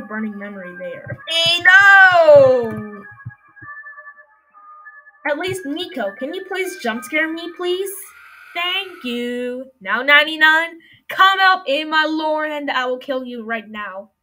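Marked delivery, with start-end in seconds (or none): 1.3-3.0 startled loud shout; scared horror game streamer. Real, not acted. 9.8-14.1 loud threat. Real, not acted. Threatening the game monster.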